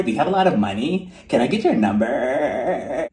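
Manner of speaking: mock seduction, stretching out key words; Erica. Client hitting on the man stretching her, mock sultry. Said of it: "she was laying there like".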